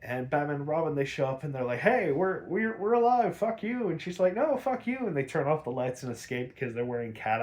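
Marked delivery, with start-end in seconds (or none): 1.8-3.9 higher, louder jeering yell; playful hammed-up taunt; mock taunt. Batman and Robin taunt in retelling. 4.3-5.0 squeaky, much higher mock comeback; mock retort. Catwoman retorts in retelling.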